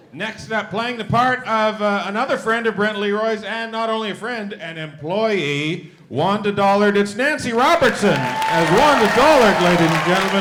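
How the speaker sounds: booming game-show announcer; announcer intro. Acted. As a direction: sell each name to the crowd. Host introduces cast members.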